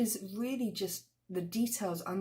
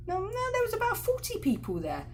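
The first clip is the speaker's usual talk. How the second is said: much higher, correcting him; contradicting; 14 semitones higher than usual. Retold or imitated. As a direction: cut him down with a pointed correction. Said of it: "And the woman will say".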